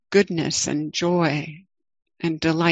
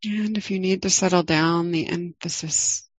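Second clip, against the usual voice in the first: guided meditation. Acted. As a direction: ease listeners into the body, gently.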